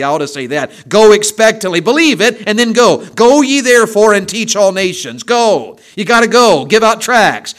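loud, high, urgent sermon voice; full-force preaching. Acted. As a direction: rouse the church to go win souls.

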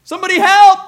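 very high, loud frantic cry for help; panicked scream for help. Retold or imitated. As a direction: shriek it like a terrified friend in a restaurant. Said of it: "the other lady goes".

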